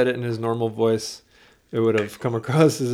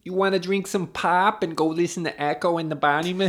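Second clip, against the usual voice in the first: higher-pitched hammed-up Midwestern accent; playful Midwestern impression, pitched up; 5 semitones higher than usual. Shown as a demonstration. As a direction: lay on thick Midwestern vowels. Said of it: "I don't know how to do a Midwestern".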